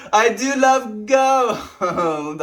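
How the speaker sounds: high, loud and very slow hammed-up voice; drawn-out mock villain delight; greedy gold lover. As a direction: ham up a greedy treasure lust.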